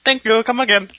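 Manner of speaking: put-on high, loud Indian shopkeeper accent; chirpy Indian accent, pitched high. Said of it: "anybody who's Indian hates me".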